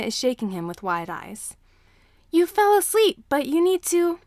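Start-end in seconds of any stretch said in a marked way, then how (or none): 2.3-4.2 put-on high, loud little boy's voice; urgent child calling. A narrator's reading. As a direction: shake him awake, urgently. Child urging teacher awake.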